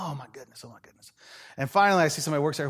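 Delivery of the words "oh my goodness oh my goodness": breathy, much softer strained whisper; exhausted gasp. Retold or imitated. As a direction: sag under the heavy kid. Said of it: "I'm like".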